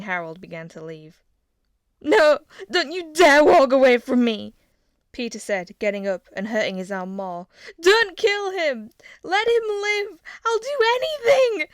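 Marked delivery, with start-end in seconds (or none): 2.0-4.5 high, very loud shout; furious shout. A narrator's reading. Father leaving, prince furious. 7.8-11.7 very high, loud, begging screams; desperate scream. A narrator's reading. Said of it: "he screamed".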